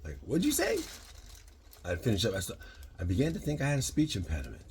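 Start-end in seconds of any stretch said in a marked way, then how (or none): 0.3-0.8 high, baffled question; startled high what'd you say; puzzled what'd you say. Retold or imitated. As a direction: mimic a confused Alabaman. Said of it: "people go".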